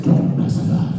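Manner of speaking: rough, loud boss warning; Vince McMahon. Retold or imitated. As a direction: imitate a stern boss, growled. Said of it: "That was Vince McMahon who just called me".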